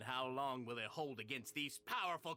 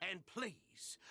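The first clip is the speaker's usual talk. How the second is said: ordinary talk, nothing marked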